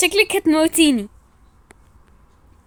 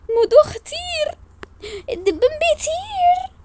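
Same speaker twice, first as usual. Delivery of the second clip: high, slow teasing singsong; high teasing kid voice; mocking rhyme; 14 semitones higher, slower than usual. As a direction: tease her in a silly high voice.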